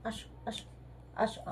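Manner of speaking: soft whisper calling the pet over; whispered coaxing. Real, not acted. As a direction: coax it close with a hush. (ফিসফিস করে ডাকা)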